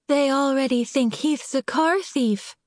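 high, tearful pleading. Acted. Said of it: "tears leaking from her brimming eyes".

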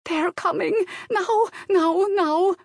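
put-on very high, urgent alarm in Slavic accent; excited Slavic-accented maid. A narrator's reading. Maid warning her employer by telephone, frantic Slavic accent. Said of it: "She got excited".

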